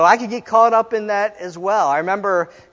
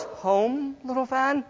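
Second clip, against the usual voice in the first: high, soft, rising question; practising the line; 4 semitones higher, 9 dB quieter, slower than usual. Acted. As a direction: try it small and high. Trying out ways to say it.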